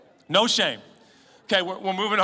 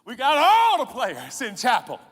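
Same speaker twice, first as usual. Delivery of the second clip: loud, higher, slowing triumphant cheer; gleeful boast; 7 semitones higher, 9 dB louder than usual. Retold or imitated. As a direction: crow about the packed chapel, rising to a shout. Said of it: "I'd be like".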